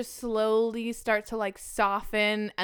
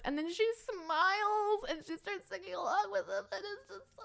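high tearful voice, fading away; choked up; 11 semitones higher, 5 dB quieter than usual. Real, not acted. Moved fan retells grandmother singing along, choked up. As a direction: tell it through tears, pitch climbing.

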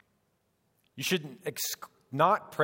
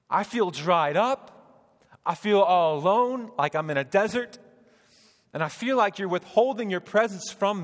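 louder, higher, pleading with God; impassioned plea to God; 5 semitones higher, 6 dB louder than usual. Retold or imitated. Preacher recalling his grief, crying out to God in a raised pleading voice. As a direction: pour out the loneliness to God, raised. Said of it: "I said".

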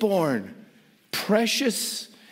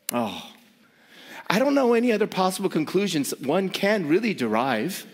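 usual speaking voice

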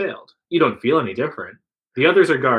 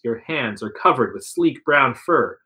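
ordinary delivery

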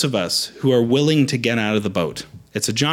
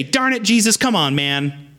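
annoyed grumble; 6 semitones higher than usual. Pretend. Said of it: "He didn't say".

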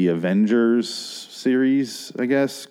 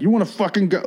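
much higher, hammed-up threat; 10 semitones higher, faster than usual. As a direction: ham up a bar brawler picking a fight. Redneck charges him, retold.

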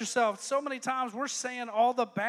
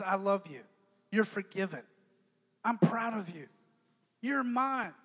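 tender voice of God; 5 semitones lower than usual. Acted. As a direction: speak it gently. Voicing God's tender words.

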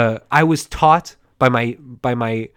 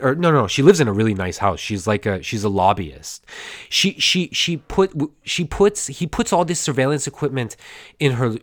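ordinary talk

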